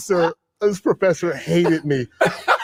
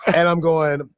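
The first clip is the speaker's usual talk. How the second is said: ordinary delivery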